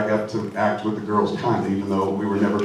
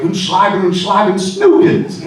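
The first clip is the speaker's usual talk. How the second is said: mock German rant, higher and louder, the last word flying up into a squeak; Hitler impression; 8 semitones higher, 8 dB louder, slower than usual. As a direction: ham up a shouty fake-German dictator. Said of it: "I think I got a Hitler down".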